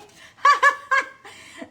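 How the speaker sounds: a burst of loud, high laughter. Real, not acted. At a viewer's joke about chili, a loud high laugh. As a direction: break into a big, high laugh.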